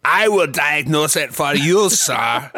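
pompous German rapper insisting, sir; German accent. Pretend. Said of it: "let me diagnose your accent here".

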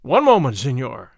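loud, commanding opening, dropping low; stern interruption, forceful then low; deep, stern voice. A narrator's reading. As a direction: cut in sternly with authority. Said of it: "a voice that was at once deep and stern".

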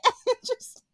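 very high, squeaky laughing speech; helpless laughter. Real, not acted. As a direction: gasp the words out through laughter. Cracking up at own impression.

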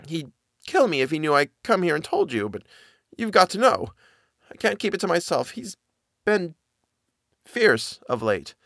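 high, halting servant voice, stumbling on the words; hesitant frightened nanny. Acted. Nanny stammers her story to Miss Genevieve, high and halting. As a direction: force out a secret she dreads telling.

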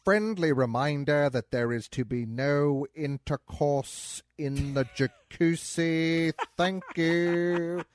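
slow, drawn-out villain drawl; Alan Rickman impression. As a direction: lean into a languid Rickman voice. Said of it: "an Alan Rickman impression".

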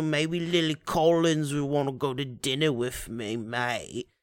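mock British accent, low and soft; hammed-up British actor's son. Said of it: "he's got a fucking British accent".

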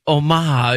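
homage said oh-MAHZH, no H; French-style homage. Shown as a demonstration. Host disputing the word, homage as French oh-MAHZH. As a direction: stretch the end of homage, oh-MAAHZH. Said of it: "But nobody says".

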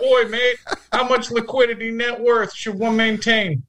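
high hammed-up Australian accent, laughing into it; a bad cartoon Australian; terrible Australian accent. Retry for listener Ben in a terrible Australian accent. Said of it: "impressively terrible".

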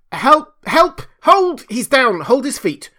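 very high, loud bursts; frantic scream. A narrator's reading. Kemp calling the crowd to help, frantic scream. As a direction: scream for help mid-fight. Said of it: "screamed Kemp".

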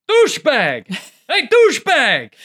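high-pitched mock holler; imitated yelling. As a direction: imitate a camper yelling at a bear. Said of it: "one of my compatriots yelling".

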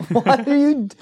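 talking around a lollipop; words round a candy in the mouth; sucking a lollipop. Real, not acted. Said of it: "let me put my lollipop down".

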